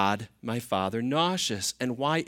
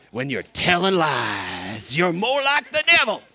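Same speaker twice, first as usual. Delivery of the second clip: put-on Southern accent; 4 semitones higher, 5 dB louder than usual. Repeating the preacher's line with relish, full-blown Southern accent. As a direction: stretch it out, then blast it in a Southern accent. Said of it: "It just works with that Southern accent".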